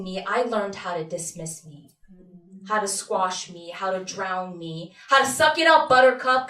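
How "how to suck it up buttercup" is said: louder, higher mock pep talk; tough dismissal. Telling herself off for feeling sad, dismissive. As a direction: push it out hard and scolding.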